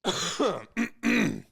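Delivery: sudden cough from water down the wrong pipe. Real, not acted. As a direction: sputter and cough on a swallow. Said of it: "water went down the wrong tube".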